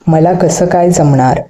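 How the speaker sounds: ordinary delivery, nothing marked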